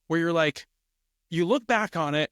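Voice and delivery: ordinary talk, nothing marked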